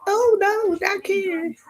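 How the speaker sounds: high pitched shocked denial. Retold or imitated. Mother reacting to news about her son, high shocked denial. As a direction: gasp it out like you refuse to believe it. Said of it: "That same thing I said".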